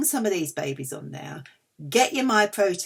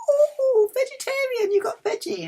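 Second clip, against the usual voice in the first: mock-excited husband, much higher voice; 4 dB louder than usual. Imitating her husband's excitement over veggie balls, much higher. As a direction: squeal it like a thrilled partner. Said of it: "He was really excited".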